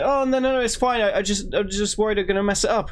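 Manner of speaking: put-on high, reassuring girlfriend voice, lightly done; a light imitation of an anxious hairdresser; imitating Steph. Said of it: "she's like".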